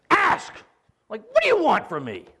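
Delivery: high, loud exasperated shout; aggressive demand. Retold or imitated. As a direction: snap it at him like a battering ram. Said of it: "these guys are just like aggressors".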